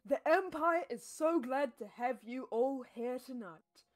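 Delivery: formal speech. Acted. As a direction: address the gathered members like a ruler. Host gives formal feast speech.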